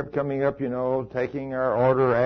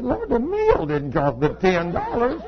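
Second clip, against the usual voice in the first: slow, higher, laughing through the words; laughing protest; 7 semitones higher, slower than usual. Retold or imitated. Preacher laughingly protesting to the Lord about the tip. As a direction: plead your case while chuckling.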